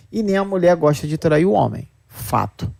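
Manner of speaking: ordinary talk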